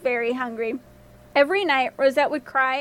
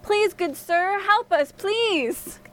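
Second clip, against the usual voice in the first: high-pitched storybook plea; acted desperate princess; pleading cry; 6 semitones higher, much slower than usual. A narrator's reading. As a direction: beg for rescue, voice lifted high. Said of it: "she cried".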